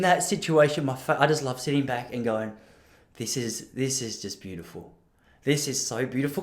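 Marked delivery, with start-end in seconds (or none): 3.2-6.4 quiet. Retold or imitated. Savouring tense room, imagined line.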